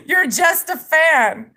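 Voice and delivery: laughing. Real, not acted. Streamer laughing at a rapper's fans, taunting. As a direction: laugh it out at them.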